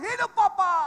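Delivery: loud, high snap of an answer; soldier-style shout. Retold or imitated. Boy answering father like soldier. (teriak tegas seperti tentara)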